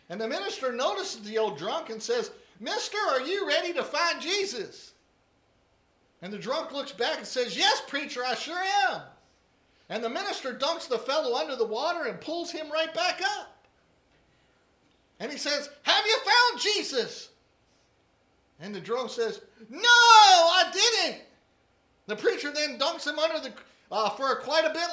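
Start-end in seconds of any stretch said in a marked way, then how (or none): 2.6-4.9 raised, louder preacher calling out. Acted. Preacher asks drunk at baptism. 7.5-9.0 put-on higher, louder eager drunk; old drunk. Drunk answers the preacher. 15.8-17.3 much higher, louder, slow preacher demand; preacher questioning the drunk after the dunk. Acted. Preacher after first dunking. 19.7-21.2 put-on much higher, very loud, slow drawn-out drunk; old drunk. Drunk answers after dunking.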